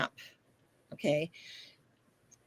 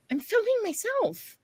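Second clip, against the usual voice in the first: repeated protest; 13 semitones higher than usual. Retold or imitated. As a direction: say it again, pleading. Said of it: "I'm like".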